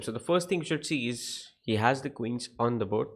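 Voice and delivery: ordinary delivery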